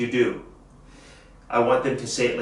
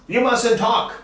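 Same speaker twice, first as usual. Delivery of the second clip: You mustn't talk, higher and quicker, stress on mustn't; brisk higher repeat; 9 semitones higher than usual. Shown as a demonstration. Teacher repeating the model line higher for the class. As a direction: bounce it back up brightly. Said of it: "Say it with me".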